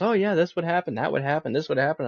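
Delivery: casual mock-mom chatter; mom's voice. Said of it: "my mom goes".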